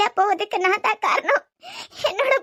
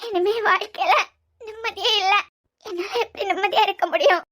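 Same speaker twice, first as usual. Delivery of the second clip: tearful sobbing; 2 semitones higher, 6 dB quieter than usual. Acted.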